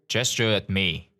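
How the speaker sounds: ordinary delivery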